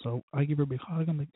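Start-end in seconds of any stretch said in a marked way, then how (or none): none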